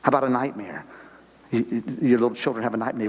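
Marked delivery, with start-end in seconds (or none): none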